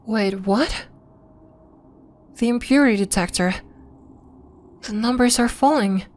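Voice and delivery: taken aback, pitch lifting as it sinks in; puzzled surprise, rising in astonishment; mildly stunned discovery; startled disbelief. Acted. Startled by falling detector numbers.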